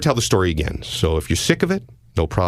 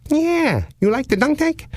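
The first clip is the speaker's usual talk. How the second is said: high, sing-song creepy caller voice; odd prank caller. Retold or imitated. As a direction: play the weird caller way up high. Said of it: "the voice at the other end says".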